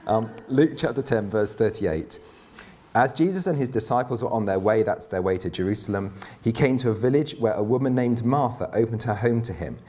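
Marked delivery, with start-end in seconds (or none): none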